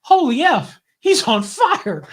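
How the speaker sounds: high, loud cry of alarm; imitated alarmed shout. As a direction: call it out like a startled bystander. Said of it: "the guy next to me goes".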